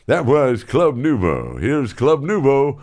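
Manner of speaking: slow DJ back-announce; imitating Rick Stevens. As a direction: lean into the on-air delivery. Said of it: "as Rick Stevens".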